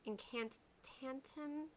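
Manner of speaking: "Incantantum" stretched out slowly and softly; slow soft name attempt. Shown as a demonstration. Softly sounding out a viewer's username. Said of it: "emphasizing the wrong syllables here".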